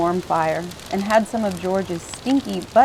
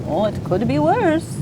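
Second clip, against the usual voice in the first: higher, cheerful easygoing sailor; cheerful George voice; 7 semitones higher than usual. A narrator's reading. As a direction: sing it out brightly, unbothered.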